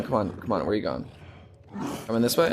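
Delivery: ordinary talk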